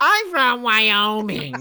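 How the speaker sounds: slow, high mock Wyoming accent; hammed-up Wyoming twang. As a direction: drag it out in a joke Wyoming accent. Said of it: "That's my good Wyoming accent".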